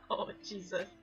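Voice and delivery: normal talking voice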